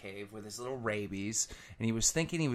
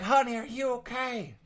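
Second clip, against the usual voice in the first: worried farmer; 11 semitones higher than usual. Acted. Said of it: "Farmer's like".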